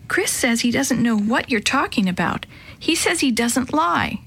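ordinary delivery, nothing marked

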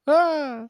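high, loud mock scream; sudden jokey shriek, laughing; freaked-out scream. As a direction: yelp as if the sword just spoke. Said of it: "Start freaking out".

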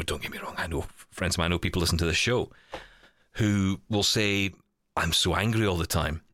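ordinary talk, nothing marked

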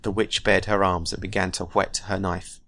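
ordinary talk

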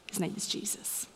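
softly whispered; whispered name. Acted. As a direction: let the name fall to a whisper. Climactic name reveal.

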